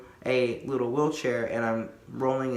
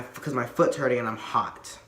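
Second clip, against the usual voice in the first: normal talking voice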